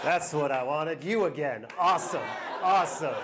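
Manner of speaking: low, half-hearted fake delight; feigned enthusiasm. Partner greeting someone home again, low and unconvincingly pleased. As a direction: pretend to be pleased, with little lift in the voice. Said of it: "It's pretending".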